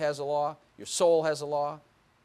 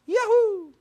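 high, loud drawn-out cheer; gleeful whoop; 8 dB louder than usual. Acted. Preacher cheering a thousand troubles, gleeful whoop. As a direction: whoop it out with sudden delight.

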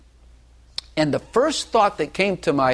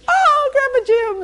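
put-on much higher, slow, sing-song child greeting; little child's voice; 6 dB louder, slower than usual. Grandfather imitating toddlers greeting him in a high child voice. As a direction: coo it like a delighted toddler.

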